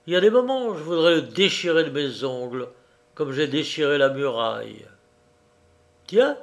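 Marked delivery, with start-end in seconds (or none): 6.1-6.4 surprised. Acted. Finds a knife unexpectedly.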